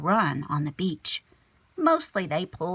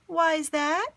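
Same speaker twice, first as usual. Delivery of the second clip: Mom asking; 10 semitones higher, 3 dB louder than usual. Acted. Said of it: "Mom asked".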